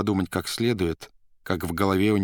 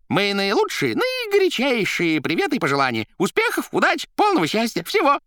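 high, loud, rattling patter; crackling chatter; 5 dB louder than usual. A narrator's reading. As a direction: rattle it off like a jester. Koroviev on phone.

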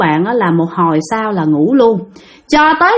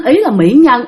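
ordinary talk, nothing marked

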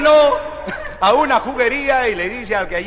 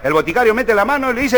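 normal talking voice

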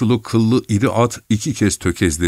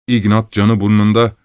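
ordinary delivery, nothing marked

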